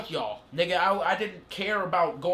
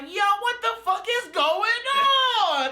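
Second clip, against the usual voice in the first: stunned confusion; 14 semitones higher, 4 dB louder than usual. Retold or imitated. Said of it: "in my head, I'm like".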